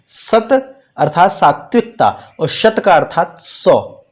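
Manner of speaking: usual speaking voice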